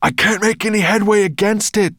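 loud, high call over the wind; calling back. A narrator's reading. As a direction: shout back against the storm. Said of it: "the dragon called back".

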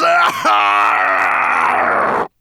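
long, loud monster roar; furious roar. A narrator's reading. Nettlebrand opening his jaws, enraged roar. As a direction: roar it out at full force. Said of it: "he roared so loud".

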